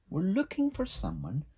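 high storybook character voice; little beaver. A narrator's reading. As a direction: speak up as an eager-to-help little beaver. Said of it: "said Little Beaver".

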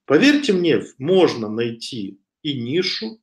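normal talking voice

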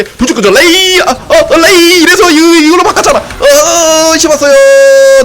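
high, loud frantic callouts; excited shout. Real, not acted. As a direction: shout the play as it happens. Shouting callouts mid-fight.

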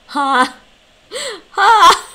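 shouted syllable, very loud. Real, not acted. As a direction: belt it out. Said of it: "Sorry. Too loud".

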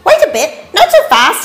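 high, quick, indignant echidna; bristling. A narrator's reading. Echidna popping up to stop Dingo, bristling. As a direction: cut in fast and high, bristling with protest. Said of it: "he bristled".

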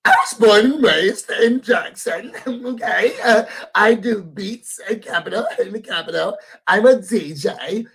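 theatrical mimicked voice with laughs; Tambra. Mimicking a reality show cast member introducing herself, theatrical impression. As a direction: imitate her theatrical way of talking, giggling through it. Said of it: "her voice sounds like that".

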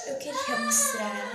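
high, loud and slow squeal. Real, not acted. Girl squealing that she wants to show something.

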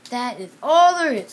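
loud, firm announcement; zookeeper announcing. Acted. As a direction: tell the seals it's over, firmly. Zookeeper announcing empty basket.

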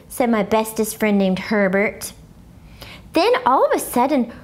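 usual speaking voice

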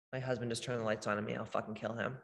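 low, soft, quick aside; muttered aside. Real, not acted. Interrupting her talk to grumble about her husband, muttered aside. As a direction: drop to a quick undertone of mock annoyance.

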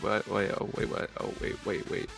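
usual speaking voice

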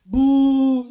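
a loud booming boo; mock boo. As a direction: boo them off the stage. Imagined rejection.

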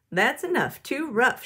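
mild storybook shout. Acted. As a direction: call out firmly, short of a real yell. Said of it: "shouted Missus Ruler".

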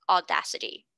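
ordinary delivery

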